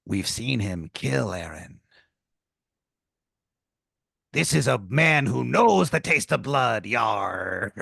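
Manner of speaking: slow, ominous pirate voice; lightly voiced sinister pirate; pirate tomato. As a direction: warn of a killer, darkly. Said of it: "And he goes".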